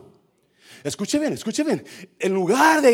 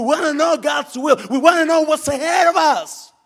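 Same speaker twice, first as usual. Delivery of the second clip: insistent demand; 8 semitones higher, 7 dB louder than usual. Acted. Voices people demanding to know.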